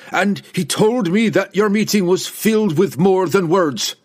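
loud, high-pitched angry accusation; heated barbarian outburst; shouted accusation. A narrator's reading. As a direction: keep shouting back, accusing her. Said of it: "Wulfgar shouted back".

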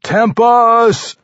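high, loud battle cry; bellowing. A narrator's reading. Barbarian hurling warhammer at the far wall, bellowing. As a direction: bellow it out, voice soaring. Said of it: "the barbarian bellowed".

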